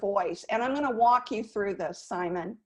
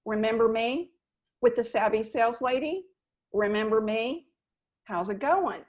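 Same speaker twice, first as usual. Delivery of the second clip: "Remember me?" rising up like a question. Shown as a demonstration. Sales coach demoing a cold call, rising questioning tone. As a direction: ask "Remember me?" with a lift at the end. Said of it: "like a question mark".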